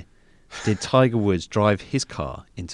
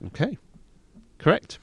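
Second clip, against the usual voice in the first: game show host; 11 semitones higher than usual. Pretend. Quizmaster confirming, Chris Tarrant style.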